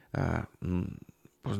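ordinary talk, nothing marked

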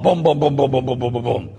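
rapid string of booms, tailing off; falling-bodies booms. Retold or imitated. Priest miming a stadium crowd falling, rapid booms. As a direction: imitate people toppling one after another.

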